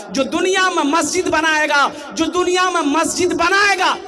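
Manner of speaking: high, loud, ringing declaration; preaching proclamation. Real, not acted. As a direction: proclaim the promise at full force. Preacher proclaims a quoted hadith.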